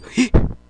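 loud high startled gasp; real fright gasp; sharp gasp. Real, not acted. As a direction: suck in air in fright. Scared by noise in game.

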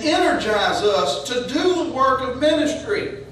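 rising preacher's build; building sermon. Acted. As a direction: preach it up toward the climax.

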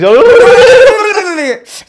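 loud, high babbled gibberish; mock possessed jabbering; speaking in tongues. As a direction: burst into shrieking nonsense syllables. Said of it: "start speaking in tongues".

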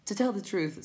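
high little creature voice; timid creature. A narrator's reading. Will-o'-the-Wisp answers the Tiny, high timid voice. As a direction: pipe up high.

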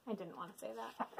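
fast, very soft grumble; quick mutter. Real, not acted. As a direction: mutter it under your breath. Forced to say Bonk.

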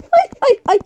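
high rapid "Ay, ay, ay"; panicked cries. Real, not acted. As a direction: squeal in alarm as it goes wrong. Panic during the game. (oyunda panikle tiz "Ay, ay, ay")

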